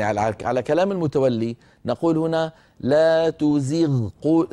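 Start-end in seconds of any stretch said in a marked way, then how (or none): 2.8-4.5 slow, drawn-out تزغ with the غ of زغ heavy; emphatic tajwid demo. Shown as a demonstration. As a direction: chant تزغ قو slowly, the غ fully emphatic. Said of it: "اسمعوني جيدا".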